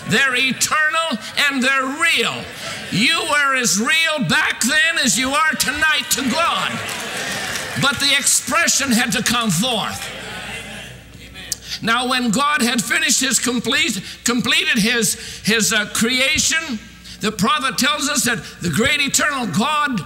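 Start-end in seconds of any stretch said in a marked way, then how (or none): none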